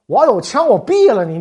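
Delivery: loud, hammed-up threat of shooting; mock furious threat. New clerk loudly threatens the Hungarian envoy, mock furious. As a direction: bark it out like a fed-up clerk.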